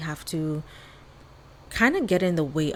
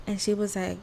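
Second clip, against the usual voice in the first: ordinary talk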